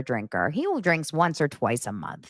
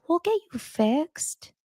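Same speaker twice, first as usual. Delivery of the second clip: high, slow soothing coo; wife soothing her sick husband, tender and high; tender mom; 11 semitones higher, slower than usual. Retold or imitated. A mom that says.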